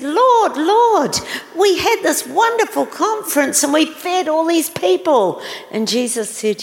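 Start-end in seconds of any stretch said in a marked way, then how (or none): 0.0-5.4 put-on high, loud, breathless child's report to the Lord; excited children. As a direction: burst out like thrilled kids telling their news.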